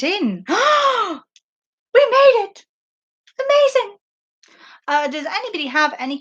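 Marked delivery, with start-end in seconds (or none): 0.5-1.2 high, sharp gasp of delight; big high-pitched gasp; excited gasp. Pretend. Celebrating end of viewer questions. 1.9-4.0 very high, squealed cheer; hammed-up high-pitched triumph; playful celebration. Celebrating end of viewer questions.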